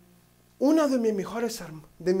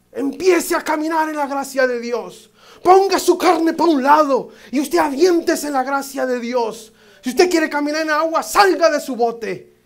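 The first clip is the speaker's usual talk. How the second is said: higher, louder, shouted exhortation; impassioned preaching; 5 semitones higher, 7 dB louder than usual. Acted. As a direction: exhort the church to stop trusting their own strength. Preaching at full force.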